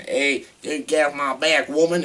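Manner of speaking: mock Texan cop accent; Reese Witherspoon's Texan accent. Reviewer mocking the actress's bad cop role, Texan accent. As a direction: put on a bad Texas twang. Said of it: "Reese Witherspoon's text".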